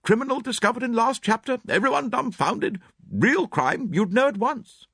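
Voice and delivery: put-on high, brisk, blunt older lady; clipped spinster, sure of herself; Miss Howard. A narrator's reading. Miss Howard voiced in reading.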